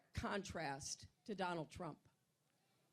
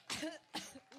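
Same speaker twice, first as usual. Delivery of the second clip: chesty cough; 5 semitones higher than usual. Real, not acted. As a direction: cough it out hard. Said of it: "my allergic reaction".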